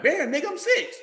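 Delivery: mock six-year-old protest. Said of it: "I'm like".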